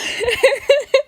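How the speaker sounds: helpless giggle fit; high laugh. Real, not acted. Cracking up during bloopers.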